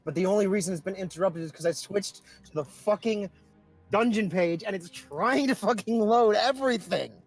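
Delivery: ordinary talk, nothing marked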